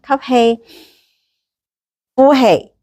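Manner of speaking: drawn-out breathing cues; slow breath counting. Shown as a demonstration. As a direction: call the breath slowly, coach-like. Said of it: "嘗試一次啦". (慢慢拉長嘅呼吸口令)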